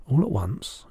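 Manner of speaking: ordinary delivery, nothing marked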